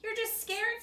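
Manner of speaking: high, teasing bunny voice; playful hare taunting a nervous friend; teasing young hare. Acted. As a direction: tease your friend for being scared. Scamp teases Hooper.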